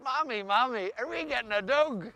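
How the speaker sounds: put-on a small boy pleading with his mother; child voice. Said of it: "he said".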